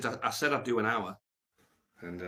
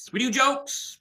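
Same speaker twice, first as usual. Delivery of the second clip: high mock insistence; 13 semitones higher, 6 dB louder, slower than usual. Real, not acted. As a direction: jump way up high and louder, insisting. Mock insistence after joke.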